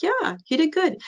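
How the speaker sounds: high, soft little voice; shy mom reply. Retold or imitated. Young mom quietly agreeing with the nurse's praise, high and soft. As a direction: answer shyly, small and high.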